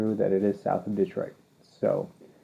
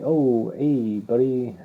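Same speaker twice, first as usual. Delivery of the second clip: put-on Canadian accent; 3 semitones higher, much slower than usual. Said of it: "or, you know, Canadians".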